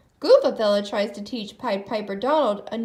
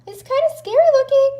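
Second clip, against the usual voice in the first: much higher, louder and fast; nervous Goofy voice; 13 semitones higher, 9 dB louder, faster than usual. A narrator's reading. Goofy eyes the spooky castle, high and quick. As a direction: blurt it out in goofy jitters.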